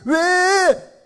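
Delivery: high, loud, drawn-out WHY; loud high-pitched rhetorical outcry; impassioned cry. Real, not acted. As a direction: cry the question out to the congregation. Preacher cries out rhetorical question.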